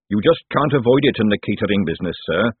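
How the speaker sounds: usual speaking voice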